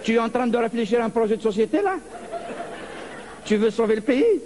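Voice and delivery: patronizing the president. Acted. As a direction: pat him on the head with your voice. Talking down to the tiny president.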